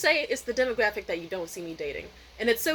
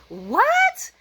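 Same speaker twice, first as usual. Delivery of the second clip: very high, loud rising squawk; shocked crowd reaction; 14 semitones higher, 8 dB louder than usual. Retold or imitated. People in background reacting to joke, shocked. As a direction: shriek it as a baffled crowd. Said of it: "people in the background say".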